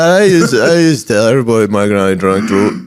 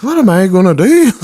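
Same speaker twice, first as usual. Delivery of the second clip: high mock panic; fretting granny; 9 semitones higher, 3 dB louder than usual. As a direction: plead it like a lost granny. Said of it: "looking at me like".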